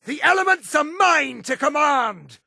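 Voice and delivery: high, commanding shout; battle boast. Acted. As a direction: proclaim mastery at a high pitch. Battle taunt of elf mage.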